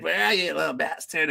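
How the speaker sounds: mock taunt. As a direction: lightly imitate a hunter taunting his prey. Retelling attacker's second taunt.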